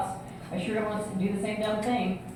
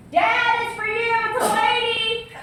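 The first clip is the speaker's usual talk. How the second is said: high sing-song call to Dad, louder; girl calling her dad; 13 semitones higher, 4 dB louder, slower than usual. Retold or imitated. Daughter calling her father to the phone, high sing-song. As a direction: sing it out across the house like a kid. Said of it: "this girl answers".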